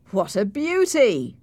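sneering mock praise; a light sarcastic sneer. A narrator's reading. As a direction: scoff at the sickly boy. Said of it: "Heathcliff said with a sarcastic laugh".

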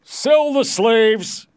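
high, drawn-out order; imperious lord's shout; loud command. A narrator's reading. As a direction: call it out slowly for all to hear. Lord orders slave dealer.

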